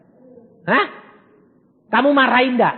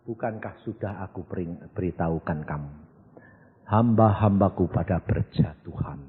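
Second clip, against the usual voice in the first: God's voice; 9 semitones lower, 5 dB quieter than usual. Retold or imitated. God's voice quoted, low and soft.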